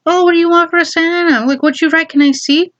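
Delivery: a hint of an inquisitive parent; curious mom. Mom asks child about Santa letter, lightly imitated curiosity.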